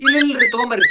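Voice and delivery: high, loud, hasty backtrack; panicked agreement. Acted. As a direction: blurt it out to appease the aunt. Startled guest hastily accepts food. (ಗಾಬರಿಯಿಂದ ಒಪ್ಪಿಕೊಳ್ಳುವುದು)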